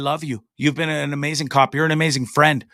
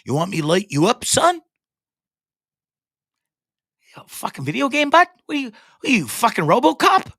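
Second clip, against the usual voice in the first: sneering cop, pitched well up, mocking him; 7 semitones higher, faster than usual. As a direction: mimic the bullying cop with contempt. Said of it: "he goes".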